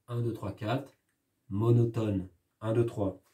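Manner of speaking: soft counting of the beats; tally the syllables quietly. Shown as a demonstration. Teacher counting beats for the class, soft. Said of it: "le rythme du poème".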